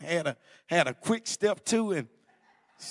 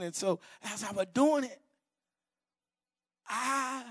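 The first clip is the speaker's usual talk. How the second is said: normal talking voice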